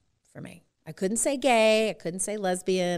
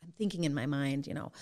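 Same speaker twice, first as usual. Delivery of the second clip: ordinary talk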